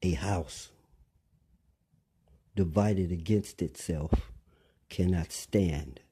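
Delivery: slow, measured phrases with long pauses; real slow. Shown as a demonstration. Speaker asking listeners to take in these words, real slow. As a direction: spell it out slowly, phrase by phrase. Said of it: "We're gonna say this again real slow".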